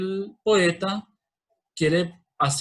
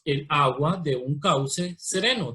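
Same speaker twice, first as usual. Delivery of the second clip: "El agua de un cauce sereno" leído despacio, sílaba por sílaba; separado en sílabas; slower than usual. Shown as a demonstration. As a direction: marca cada sílaba del verso sin unirlas. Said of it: "aquí las separamos en sílabas". (separando cada sílaba con calma)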